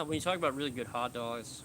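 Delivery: usual speaking voice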